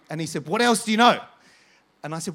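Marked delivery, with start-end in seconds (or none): 0.5-1.2 loud, raised-pitch outburst; retold insistent demand. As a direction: imitate a desperate man demanding an answer. Retold man repeats his demand insistently.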